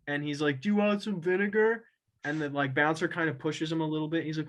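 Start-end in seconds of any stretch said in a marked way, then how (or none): 0.6-1.9 higher, odd put-on voice of a high stranger; mock crackhead. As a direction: play the spaced-out guy offering vinegar. Crackhead offers vinegar, retold.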